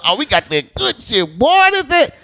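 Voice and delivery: gloat, then balk at it; mock excitement. Testing the fake heroin, excited then shocked.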